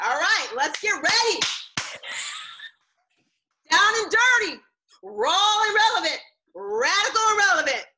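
high, loud, rowdy hype; hyped-up cheer. Real, not acted. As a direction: fire up the crowd with each slogan. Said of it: "This is what she's like".

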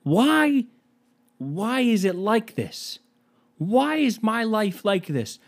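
high, drawn-out wail of why; mock-anguished, stretched-out pleading; comic despair. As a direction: lament slowly to the heavens. Comic despair at his life.

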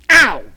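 sudden loud yelp of pain; sharp ow. Acted. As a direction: yelp as your foot gets stomped. Stepped on foot, cries out.

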